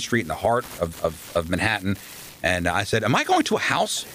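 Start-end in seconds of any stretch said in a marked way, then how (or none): 3.0-4.1 high incredulous question. Retold or imitated. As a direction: pitch shoots way up in disbelief. Stunned he must live at station.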